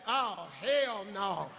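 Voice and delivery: drawn-out, deflated disbelief; slow dismay. Retold or imitated. Contestant seeing the board, slow stunned dismay. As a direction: let it sink in slowly.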